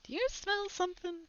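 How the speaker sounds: a mom shooing her little son outside, high and sweet. Acted. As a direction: sweetly nudge the kid out the door. Mom voiced in story.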